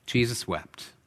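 Jesus wept said softly and slowly, falling at the end; solemn hush. Shown as a demonstration. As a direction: let the verse sink in quietly. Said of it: "the one verse everybody has memorized".

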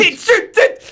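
very high, sputtered French exclamations; imitated frantic stammering. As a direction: spit out a comic panicked stutter. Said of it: "hij, hij stammelt zo".

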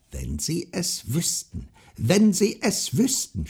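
high, slow, rising goblin shriek; screaming goblin. A narrator's reading. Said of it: "schrie er".

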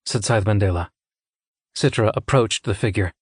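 ordinary delivery, nothing marked

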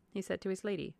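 usual speaking voice

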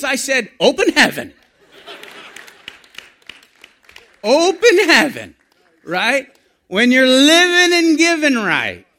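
loud, high, exuberant preacher; full-force preaching. Acted. Preacher urging church to expect blessing, exuberant full force. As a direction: belt out the punchline, then drive it home.

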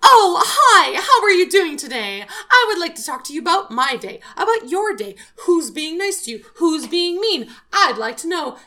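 high, loud gushing sweetness; overly nice customer. Retold or imitated. Suspicious customer gushing at the clerk, high and loud. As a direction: pour on the fake friendliness. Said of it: "But you're like".

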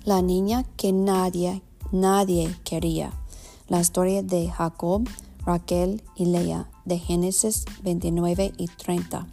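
Spanish with an American accent. Real, not acted. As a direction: read Spanish as an English speaker. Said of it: "perdona mi español".

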